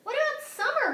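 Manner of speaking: small brother protesting; brother calling. Acted. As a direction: call after her, dismayed. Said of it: "her brother called".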